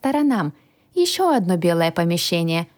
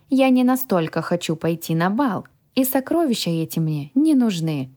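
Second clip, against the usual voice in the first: ordinary delivery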